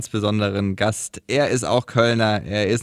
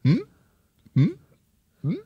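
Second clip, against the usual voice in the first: little questioning hums, rising sharply; puzzled hum like a curious seal; naively clueless; 8 semitones higher than usual. Retold or imitated. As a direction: imitate baffled newcomers to the camera. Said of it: "diese naive Unbeholfenheit".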